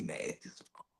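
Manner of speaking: whispered disgust; breathy mock-secret whisper. Real, not acted. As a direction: hiss it under the breath. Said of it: "whispered repeat".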